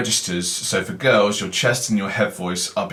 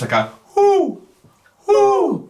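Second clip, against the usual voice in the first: much higher hooting "whoo"; owl hoot; 4 dB louder, slower than usual. Shown as a demonstration. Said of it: "imitate like an owl noise".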